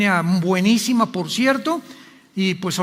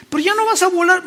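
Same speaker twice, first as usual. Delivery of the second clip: a hint of the wife, higher; light wife voice; 8 semitones higher, faster than usual. Quotes his wife Marta. (un toque de la voz de la esposa, más aguda)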